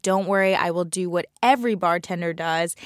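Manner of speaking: normal talking voice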